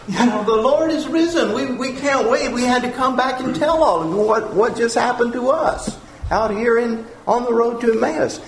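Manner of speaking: high, eager rush of news; excited and breathless. Pretend. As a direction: blurt it out like you ran seven miles. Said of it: "they're out of breath".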